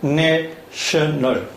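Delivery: "international." drawn out syllable by syllable, very slowly; very slow model word. Shown as a demonstration. Teacher slowly models "international." syllable by syllable for the class. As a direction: stretch every syllable of "international." out for dictation. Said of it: "다시 한번 해볼까요?".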